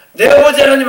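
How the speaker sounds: high, loud confident calling out; bold cry to God. Shown as a demonstration. Preacher showing the congregation how to call boldly to God, high and loud. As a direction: call out to God as your own father. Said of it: "하면서 나가야 돼요".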